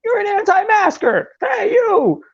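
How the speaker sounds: very high, whiny mock of an angry commenter; mimicking an Instagram commenter scolding him. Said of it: "you leave me a big paragraph like".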